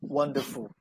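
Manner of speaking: ordinary talk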